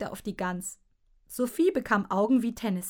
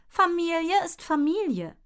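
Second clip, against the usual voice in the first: high grumbling retort; mother snapping back; 9 semitones higher, 4 dB louder than usual. A narrator's reading. Mother snaps back at dad over family. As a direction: snap it back, high and peeved. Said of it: "motzte Mutter zurück".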